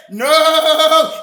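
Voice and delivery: usual speaking voice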